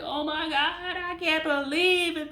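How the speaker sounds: high, mocking shocked gasp; mocking someone devastated by heartbreak; mock dramatic disbelief. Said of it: "Be like".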